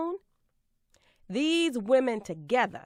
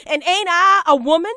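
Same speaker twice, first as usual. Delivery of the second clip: higher, louder and fast, rising at the end; ringing loud challenge; 5 semitones higher, 9 dB louder, faster than usual. Acted. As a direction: hurl it at the whole room. Climax of the refrain.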